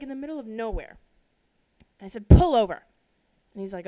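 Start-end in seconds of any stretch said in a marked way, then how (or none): 2.3-2.8 very loud two-word shout; yelled. Retold or imitated. Daughter repeating her order to dad, yelling. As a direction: bellow the order in one burst. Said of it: "I yelled at him".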